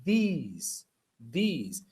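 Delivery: THESE, THESE, each with a long "ee"; teacher drawing out the vowel in THESE; pronunciation model. Shown as a demonstration. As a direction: say THESE twice, separately, rhyming with "please". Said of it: "se pronuncia así".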